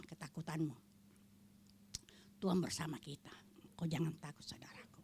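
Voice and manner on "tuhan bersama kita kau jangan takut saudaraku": a hushed, lower promise; soft whisper. Acted. Comforting congregation, voice drops.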